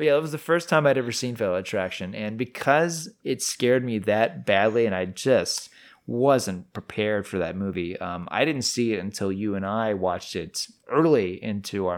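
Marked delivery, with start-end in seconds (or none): none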